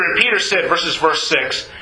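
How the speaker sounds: usual speaking voice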